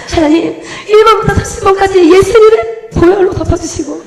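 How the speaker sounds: high, loud revival-style pleading; hammed-up fervent prayer. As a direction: build into a desperate mock prayer. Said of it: "얼마나 간절했는지 몰라요". (부흥회처럼 간절히 부르짖는 기도)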